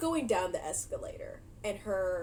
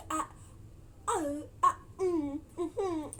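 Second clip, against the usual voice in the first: high choked stammering sounds; a touch of stammering; stunned stammer; 7 semitones higher, slower than usual. Retold or imitated. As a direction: splutter with nothing coming out. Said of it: "She's stunned".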